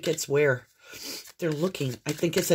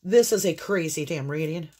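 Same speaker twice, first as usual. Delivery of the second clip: ordinary delivery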